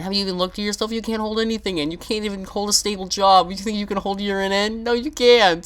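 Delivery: roast the listener shrilly; mocking taunt. Mock insulting the viewer.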